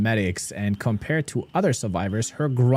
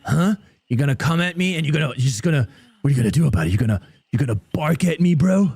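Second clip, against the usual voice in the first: put-on drunk tough guy. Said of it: "I'm like".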